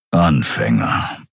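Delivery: slow, low lion-man grumble. Acted. Keru answers Nelen, slow low grumble. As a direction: growl it out, dismissive. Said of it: "brummte Keru".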